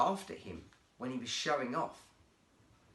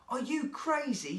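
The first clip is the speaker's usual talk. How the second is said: higher and louder, aghast; shocked friend; 10 semitones higher, 4 dB louder than usual. Acted. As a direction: react like your mate just did something mad. Jake shocked at Brian's prank.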